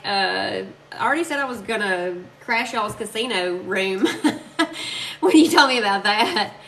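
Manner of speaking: usual speaking voice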